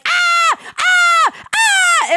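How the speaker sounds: loud high repeated shrieks; excited yelling. Retold or imitated. Friends pointing at each other at the party, loud excited shrieks. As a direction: scream with joy at each other.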